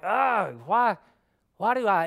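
exasperated groan into self-questioning; groan, then question yourself in mild frustration; frustrated. Acted. Person frustrated with his own weakness, exasperated. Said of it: "you'll get frustrated".

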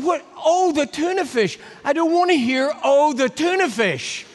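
high, loud, exasperated mimicry; angry mimic of a sheepish excuse; exasperated mocking. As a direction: throw his own words back at him, mocking. Preacher mocking Carlos, exasperated.

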